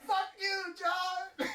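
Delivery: high angry yell. Retold or imitated. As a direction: spit out the name in anger. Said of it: "he was like".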